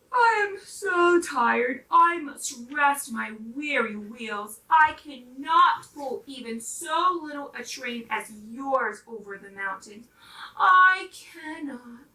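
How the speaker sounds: slow, weary, heavy-hearted voice; tired old engine. Acted. Rusty old engine refusing the dolls, slow and weary. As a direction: drag each word like a worn-out machine. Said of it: "the rusty old engine sighed".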